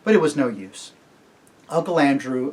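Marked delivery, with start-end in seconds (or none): none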